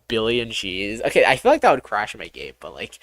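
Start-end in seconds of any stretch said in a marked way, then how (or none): none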